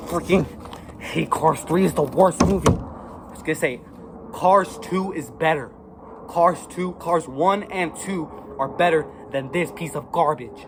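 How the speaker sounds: loud, emphatic condemnation; angry verdict. Real, not acted. Kid declaring Cars three the worst, angrily. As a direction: hammer home the hate. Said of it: "It just makes me so angry".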